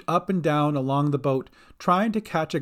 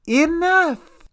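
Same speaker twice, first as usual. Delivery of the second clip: very high, loud villain cry; sharp command; 7 dB louder than usual. A narrator's reading. Dolly silences insulting Bink, sharp command. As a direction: cut in, pitched way up.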